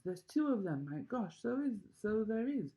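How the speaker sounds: high, loud, tumbling cries; counting in wonder; the crowd shouting over each other at the little men; excited shouting. A narrator's reading. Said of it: "everyone started shouting at once".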